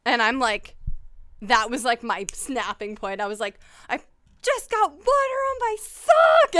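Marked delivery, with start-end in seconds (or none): 3.9-6.5 high, loud and slow, stretched in fury; mad outburst. Retold or imitated. Reliving the snapping point at the sink, furious high wail. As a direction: wail it like the last straw. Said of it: "so mad".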